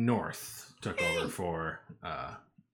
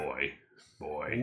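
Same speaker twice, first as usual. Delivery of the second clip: Kratos voice; 5 semitones lower, 5 dB quieter than usual. Pretend. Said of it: "Everybody give me your best boy".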